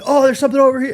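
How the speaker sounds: put-on high excited child; kid voice. Kids on canoe, voiced child.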